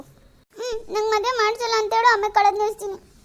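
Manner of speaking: high, weepy bargaining; sulky tearful. Acted. Daughter bargaining with Satish while crying. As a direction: sniffle and bargain.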